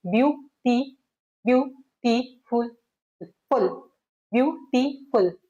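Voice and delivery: Beautiful slowly chopped into three syllables, repeated; syllable-by-syllable demo. Shown as a demonstration. Teacher demonstrates the hand-on-chin trick, beautiful slowly syllable by syllable. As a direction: pause after every syllable of beautiful, as if counting. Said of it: "syllables कैसे देखते हैं".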